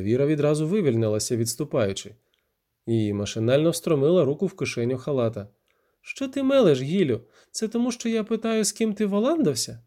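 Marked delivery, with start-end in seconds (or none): 6.1-9.8 high-pitched indignant accusation; angry denial. Acted. Віра denying his suspicion, angrily accusing him. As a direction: turn the blame on him in a high voice.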